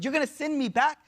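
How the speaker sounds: high, incredulous retold protest; imitated pleading question; mild mock scream. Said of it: "He starts screaming".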